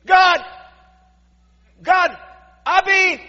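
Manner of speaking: high bursts of acted cursing; shouted curse. Peter calls down curses on himself, high shouted bursts. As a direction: start an oath in loud, broken bursts. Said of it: "began to call down curses on himself".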